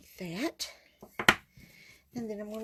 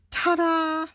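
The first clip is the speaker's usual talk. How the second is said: much higher, louder triumphant "Ta-da!"; ta-da reveal; 8 semitones higher, 9 dB louder than usual. Real, not acted. Crafter showing viewers the cleaned stamp, loud high ta-da. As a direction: show it off like a magic trick.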